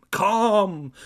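high, loud yell; sudden forceful outburst; wrathful calm. Acted. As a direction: shout the soothing word wrathfully. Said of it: "the wrath of calm".

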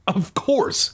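high, over-bright reassurance; falsely cheerful. A narrator's reading. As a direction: reassure a little too cheerfully. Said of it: "too cheerful".

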